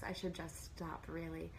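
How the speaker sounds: ordinary delivery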